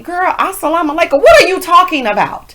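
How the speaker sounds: high, loud disbelief; incredulous. Real, not acted. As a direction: call her out in disbelief. Mocking mispronunciation incredulously.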